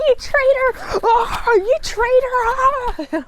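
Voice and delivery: high, hammed-up wail of betrayal; Kirk. Captain accusing his first officer of betrayal, melodramatic outrage. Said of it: "Kirk's like".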